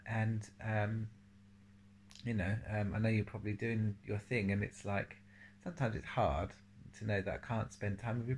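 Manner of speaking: soft, fast, loving message; tender goodbye. Real, not acted. Grandparent telling grandchild they think of them, tender. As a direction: speak gently to someone far away.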